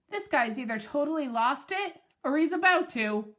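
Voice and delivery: usual speaking voice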